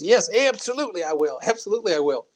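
high, eager Southern accent imitation; Howard Coble Southern accent. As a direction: imitate an older Southerner agreeing instantly. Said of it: "And he talked like this".

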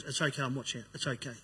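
quietly calm a panicking husband; gentle reassurance. Retold or imitated. Wife reassuring him during attack.

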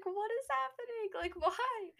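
baffled. Retold or imitated. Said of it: "I was just like".

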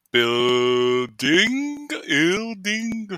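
"building" squeaked high, mangled and drawn out; mock the wrong way to say "building"; silly mispronunciation. Shown as a demonstration. Teacher mocks students, "building" squeaked high and mangled. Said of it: "not even a word".

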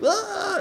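high-pitched party "ah"; mock a mindless partygoer's cry; mindless party yell. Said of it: "mindless party".

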